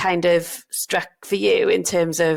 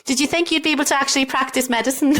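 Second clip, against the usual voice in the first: higher, quick, laughing question; laughing tease; 6 semitones higher, faster than usual. Real, not acted. Doctor teasing co-host about naivety, laughing. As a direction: tease through your laughter.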